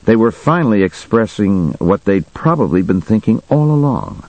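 usual speaking voice

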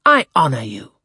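ardent, emphatic gentleman; heartfelt admiration, firmly voiced; enthusiastic. A narrator's reading. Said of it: "catching the same tone".

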